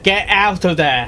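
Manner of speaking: panicked shout to a character; loud shout. Pretend. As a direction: yell a warning at the screen. Reactor shouts along with story.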